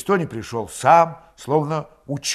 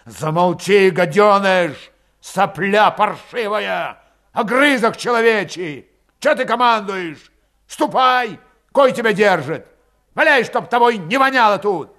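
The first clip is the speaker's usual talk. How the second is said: loud, raised, furious abuse; enraged; 8 semitones higher, 7 dB louder than usual. A narrator's reading. Grigory insulting Valet and ordering him out, enraged. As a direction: lash out with barely held-back rage. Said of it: "Выкрик Валета взбесил его окончательно".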